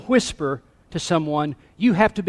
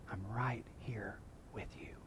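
barely audible, intimate whisper; very soft, low, hushed assurance; 6 semitones lower, 23 dB quieter than usual. Acted. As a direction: whisper it as God drawing close. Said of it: "the reason that he whispered to Elijah".

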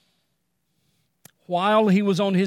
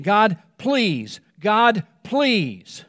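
imitating Paul begging God, a touch louder; Paul pleading; 3 semitones higher, 3 dB louder, much slower than usual. Paul on his knees begging God to take the pain, pleading. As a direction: plead over and over, pressing harder. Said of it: "pleading with God again and again".

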